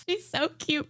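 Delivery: ordinary talk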